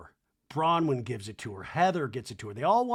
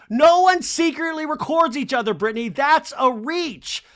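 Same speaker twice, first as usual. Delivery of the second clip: high, loud mock indignation; imitated loud rebuttal; 11 semitones higher, 8 dB louder than usual. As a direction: call out the objection, stretching the end. Acting out Heather's loud rebuttal.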